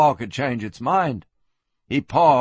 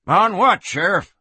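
put-on drunk voice; 7 semitones higher, 4 dB louder than usual. A narrator's reading.

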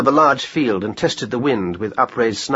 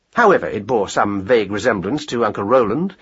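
ordinary delivery

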